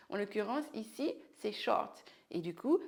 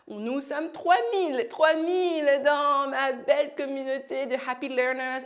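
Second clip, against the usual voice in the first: loud, proud celebration; excited announcement; 4 semitones higher, 8 dB louder than usual. Real, not acted. Teacher celebrating three thousand followers, excited. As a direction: announce the milestone with joy.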